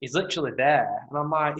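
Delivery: normal talking voice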